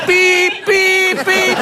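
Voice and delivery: high, loud repeated truck beeps; reversing beeper. Retold or imitated. Partner backing into bed like a truck, high beeping. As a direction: imitate a lorry's reversing alarm. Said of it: "They just back it in".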